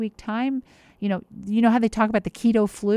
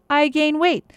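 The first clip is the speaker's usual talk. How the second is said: emphatic punchline; 8 semitones higher, 6 dB louder, slower than usual. Real, not acted. Punchline of her own diet.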